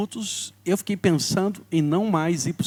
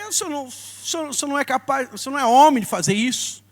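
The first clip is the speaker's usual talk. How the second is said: higher, heated protest at his father; retold anger; 7 semitones higher, faster than usual. Son confronting his father in a heated, higher voice. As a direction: relive the angry outburst at dad. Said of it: "fiquei muito brabo".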